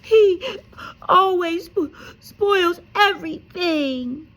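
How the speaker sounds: high, loud fake wail; loud high slow pretend crying; fake sobbing. A narrator's reading. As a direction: blubber it to get his way. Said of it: "between huge pretend sobs".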